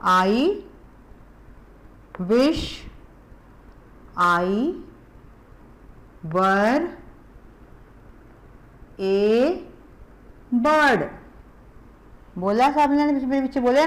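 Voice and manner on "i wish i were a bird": word by word with long pauses for repeating; slow model reading. Shown as a demonstration. Teacher reading a poem line for children, slow and separated. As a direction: read each word slowly for kids to echo. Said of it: "फिर बोलो".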